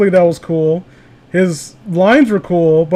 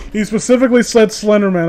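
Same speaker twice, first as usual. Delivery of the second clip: usual speaking voice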